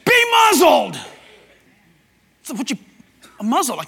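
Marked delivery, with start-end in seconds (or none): 0.0-1.0 high, loud commanding shout; Jesus shouting at the demon. Acted. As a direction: roar it as Jesus silencing a demon. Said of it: "Jesus shouted".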